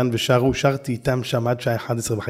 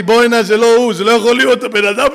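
much louder, higher amazed cry; amazed outburst; 8 semitones higher, 9 dB louder than usual. Retold or imitated. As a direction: cry out in disbelief. (קריאה נדהמת ורמה מאוד)

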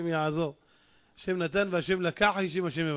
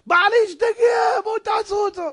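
hysterical cry; 12 semitones higher, 8 dB louder than usual. Acted. Wife calls people to stop crazed husband, hysterical cry. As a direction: scream for help as the wife.

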